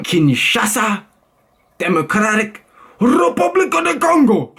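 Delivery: very high, booming mock Congolese accent, rolled r. Vlogger announcing Kinshasa from a list, over-the-top Congolese accent. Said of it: "change my voice and do some impressions".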